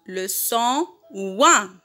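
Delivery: slow, drawn out for repeating; teacher's slow repeat-after-me delivery; slow model pronunciation. Shown as a demonstration. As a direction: model it slowly, stretching "ouin" with a falling end. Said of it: "Répétez la troisième fois".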